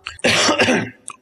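sputtering choke on gum; choking cough. Real, not acted. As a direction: cough like something went down wrong. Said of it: "choking on gum juice".

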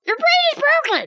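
high-pitched, incredulous scolding; exasperated outburst. Acted. Listener scolding the cat-hating storyteller, exasperated outburst. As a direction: throw it out in exasperation.

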